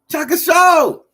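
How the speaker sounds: high loud shout of the name; hype shout. Real, not acted. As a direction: call the name out big. Hyping a viewer's name.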